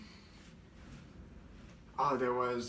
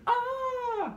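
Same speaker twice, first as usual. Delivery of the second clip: put-on long, piercingly high baby shriek; imitated baby scream; 4 dB louder than usual. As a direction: let out a demented baby's scream. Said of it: "It's like screaming".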